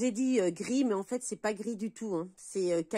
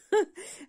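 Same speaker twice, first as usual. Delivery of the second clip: short, high, louder laugh; 8 semitones higher, 5 dB louder than usual. Real, not acted. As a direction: burst out in a brief high laugh. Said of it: "Maintenant, ça me fait rire". (petit rire aigu et sonore)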